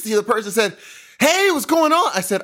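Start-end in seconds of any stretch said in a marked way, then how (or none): 1.2-2.1 chirpy barista. Retold or imitated.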